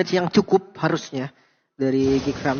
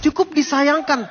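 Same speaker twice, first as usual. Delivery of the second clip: hyped fast play-by-play, high and loud; esports caster; 10 semitones higher, 7 dB louder than usual. Acted. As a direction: call the teamfight at full hype. Live fight call. (komentator esports yang heboh dan bersemangat)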